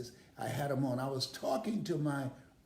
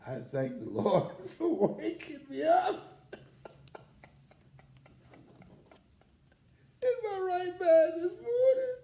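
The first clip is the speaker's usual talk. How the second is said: much higher and louder, laughing and crying; laughing through tears; 6 dB louder than usual. Real, not acted. Grateful speaker thanks the Lord for his memory, laughing and crying. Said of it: "Now I say it".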